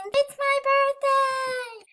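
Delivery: stretched out high and slow, thrilled; excited birthday shout. Real, not acted. Announcing birthday to viewers.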